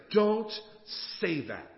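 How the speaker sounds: firm wife's rebuke, punched first word; kinda loud. Acted. Wife correcting her sleepless husband, firm and somewhat loud. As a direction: scold firmly, pausing after the first word. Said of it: "she said it kinda loudly".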